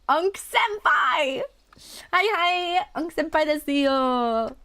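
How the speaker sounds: put-on high squeaky anime girl voice; cutesy anime fangirl squeal; breathy cutesy giggly. Streamer doing anime cutesy greeting.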